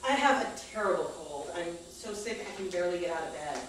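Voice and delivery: ordinary delivery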